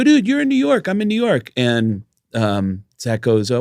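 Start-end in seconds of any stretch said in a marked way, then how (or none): 0.0-1.5 much higher, eager retold greeting; excited retelling. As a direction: replay the excited hello to a friend. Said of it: "I go".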